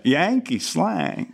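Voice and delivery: slow, high, overdone American twang; caricature Yankee accent. Shown as a demonstration. Actor hamming a bad American accent on the director's note. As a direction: stretch it like a cartoon Yankee. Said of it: "Just think caricature instead of accent".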